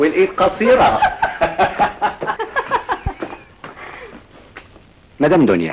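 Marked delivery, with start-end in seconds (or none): 1.1-3.3 high airy burst of laughter. Acted. Husband laughing at his own joke to his wife, high and airy.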